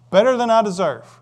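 hearty greeting reply. Acted. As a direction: call it out like a happy believer. Acting a cheerful greeting reply.

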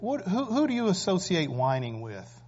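ordinary talk, nothing marked

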